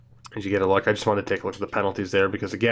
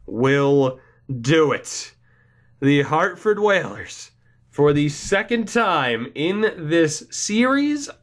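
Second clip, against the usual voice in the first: slow, drawn-out and higher, savouring the moment; stunned excitement; 4 semitones higher, slower than usual. Real, not acted. Gamer announcing his team clinched the series, stunned and thrilled. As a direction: let the win sink in, word by word.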